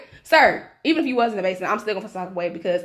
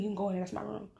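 imitated near-whisper, fading away; secretive, barely audible mumble; voice dropping lower; 13 dB quieter, faster than usual. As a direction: let it sink to a very soft hush. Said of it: "his voice is keeping lowering".

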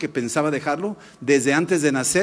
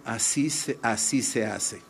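voice of God; 6 semitones lower, 4 dB quieter than usual. Retold or imitated. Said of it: "Dios me estaba diciendo".

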